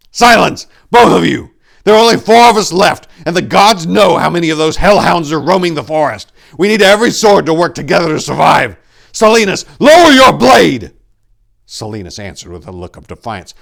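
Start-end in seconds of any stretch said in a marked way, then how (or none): 0.1-10.9 high, loud authoritative orders; loud commanding shout. A narrator's reading. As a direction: bark orders to stop the fight. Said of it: "Tor commanded".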